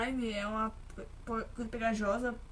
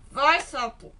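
louder push, vai sapo shouted; urging shout; 11 dB louder, much slower than usual. Real, not acted. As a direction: shove the frog on with a loud cry. Sending frog through hole. (grito de incentivo, mais alto)

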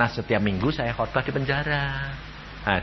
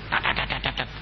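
mock machine gun; 6 dB quieter than usual. Imitating machine gun fire.

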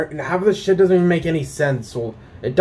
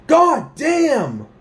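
much higher, slow angry shout; road rage shout; 12 semitones higher than usual. Retold or imitated. Retelling a driver cutting them off, shouting. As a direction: yell at the driver who cut you off.

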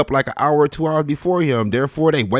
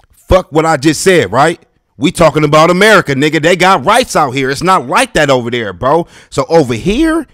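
loud rant; 2 semitones higher, 7 dB louder than usual. Real, not acted. Worked-up rant, calling out.

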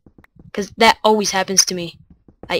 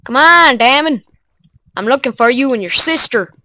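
calling out; 4 semitones higher, 5 dB louder than usual. Real, not acted. Calling to mobs.

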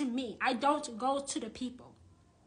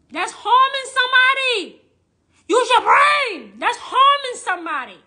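a real outburst, much louder and higher; furious yelling; 9 semitones higher, 14 dB louder than usual. Real, not acted. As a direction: yell it at them with real fury. Furious rant about scammers.

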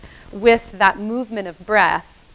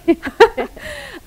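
high laugh; 3 dB louder than usual. Real, not acted. Laughs after opera remark.

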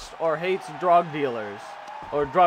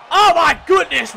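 much higher and louder screamed call; excited shout; 11 semitones higher, 10 dB louder than usual. Real, not acted. Commentator watching Rappin' Red go flying, hyped scream.

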